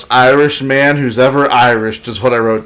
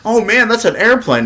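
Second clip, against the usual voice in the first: higher, impressed Goku; mock surprise; 10 semitones higher than usual. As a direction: act amazed at the airplane. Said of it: "and he's like".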